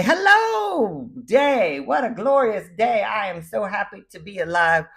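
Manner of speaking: high, loud, bursting with joy at the new day; cheerful morning greeting. Pretend. Waking up choosing joy, gushing to the day. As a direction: greet the day like it's a gift. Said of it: "you get to choose to say".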